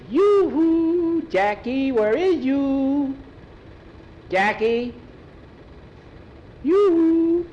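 put-on a bouncing boy hunting for his friend, slow on where is you; Skeeter calling. Boy calls for his friend in the barn, high and sing-song. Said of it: "Skeeter came in bouncing".

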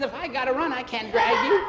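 high, loud complaint; exasperated scolding. Acted. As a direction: scold a clinging friend. Boys squabble while trapped.

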